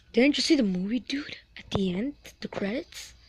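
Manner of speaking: hushed secretive whisper; breathy conspiratorial whisper. Acted. Sharing a secret about the pigs.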